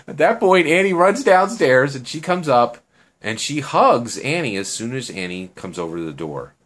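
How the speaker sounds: plain reading voice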